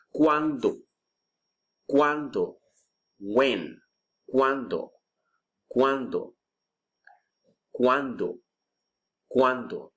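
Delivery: ¿Cuándo? modelled clearly, falling tune, for repeating; drill word. Shown as a demonstration. Teacher drilling students: ¿Cuándo? modelled clearly. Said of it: "Let's practice pronunciation".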